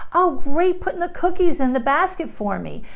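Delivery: put-on bright, encouraging praise to a child; cheerful parent praise, pitch up; praising parent voice. Shown as a demonstration. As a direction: praise the little one warmly. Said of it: "Little things like that".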